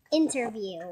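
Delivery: ordinary talk, nothing marked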